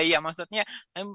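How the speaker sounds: normal talking voice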